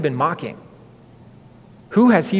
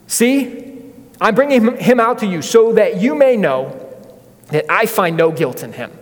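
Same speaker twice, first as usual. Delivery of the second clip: a touch of Pilate, pitched higher and louder; Pilate pleading; 5 semitones higher, 4 dB louder than usual. Retold or imitated. Pilate to the crowd, urging, higher and louder. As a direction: announce him to the crowd, hoping they relent.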